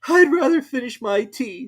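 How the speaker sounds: high, slow, nervous; high slow anxious Hatter. A narrator's reading. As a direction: say it eyeing the Queen. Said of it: "with an anxious look".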